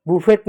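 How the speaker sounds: बुफेट with a sounded final t; mispronunciation. Shown as a demonstration. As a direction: close बुफेट on a t. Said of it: "बुफेट नहीं". (बुफेट, 'ट' के साथ गलत उच्चारण)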